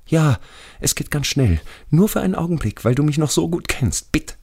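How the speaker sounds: sehr hohe, flehende Stimme; ängstlicher toter Schulfreund. Acted. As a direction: als toter Freund zum Mitkommen überreden. Radi begs narrator to come. (hoch, drängend und bittend)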